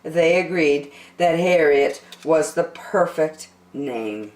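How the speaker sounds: ordinary delivery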